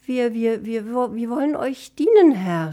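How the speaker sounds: intimidated servant's offer; nervous stammer. Acted. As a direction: stumble over the first word, timidly. Elf nervously stammers to master.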